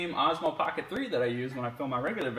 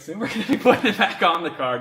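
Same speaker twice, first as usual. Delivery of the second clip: laughing; 6 semitones higher, 8 dB louder, faster than usual. Real, not acted. Laughing through the line.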